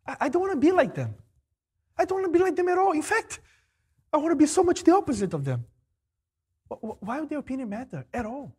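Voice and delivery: working himself up, pitch climbing; mounting indignation. Retold or imitated.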